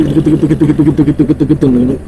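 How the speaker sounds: imitating teeth chattering from the cold. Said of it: "பல்லுக்கெல்லாம் ஆடுது".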